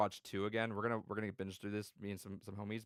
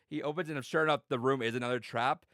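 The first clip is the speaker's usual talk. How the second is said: usual speaking voice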